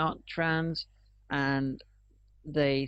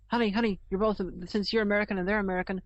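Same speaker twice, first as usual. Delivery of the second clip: higher-pitched, excitedly naive questioning; mock eager tourist; 5 semitones higher than usual. Friend playing an American introducing two compatriots, mock eager. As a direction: play a tourist thrilled by a coincidence.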